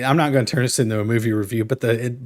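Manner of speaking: usual speaking voice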